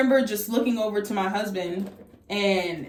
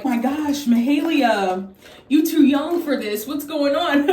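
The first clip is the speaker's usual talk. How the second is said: higher, louder mock-jovial doctor, breaking into laughter; laughing; 5 semitones higher, 4 dB louder than usual. Doctor greeting patient on Zoom, imitated laughing delivery. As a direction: imitate him making light of it, ending in a laugh. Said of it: "he answers the phone laughing".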